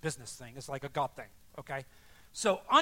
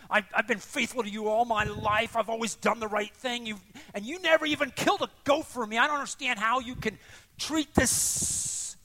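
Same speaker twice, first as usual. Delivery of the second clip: loud, high-pitched angry tirade; vehement outburst; 5 semitones higher, 5 dB louder than usual. Acted. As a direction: pour out resentment as the wronged elder son. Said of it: "vehement language coming out of him".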